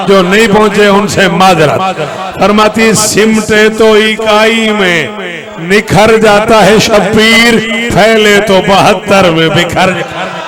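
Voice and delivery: very loud, sustained declamation; full-force recitation. Acted. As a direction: belt the verse out to a huge gathering.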